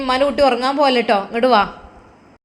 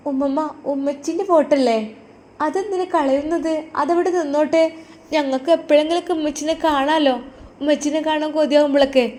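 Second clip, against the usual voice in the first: put-on a small girl worried for her dad; little girl; 5 semitones higher than usual. Daughter pleads.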